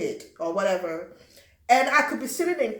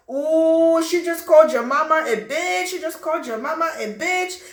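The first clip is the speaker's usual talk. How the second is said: loud, higher excited jeering; egging on a fight; 7 semitones higher, 7 dB louder than usual. Retold or imitated. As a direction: stir up the fight, delighted.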